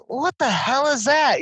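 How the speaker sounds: high, drawn-out mock bewilderment; imitated disbelief. Man imitating his wife hearing Siri's accent, exaggerated disbelief. Wife's reaction to Siri voice retold.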